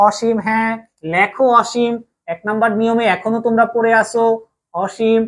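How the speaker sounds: ordinary talk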